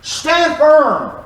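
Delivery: snapped out high, a parent losing patience; impatient scolding. Retold or imitated. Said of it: "a very impatient tone".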